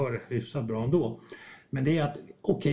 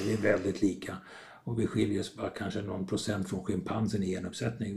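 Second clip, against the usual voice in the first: normal talking voice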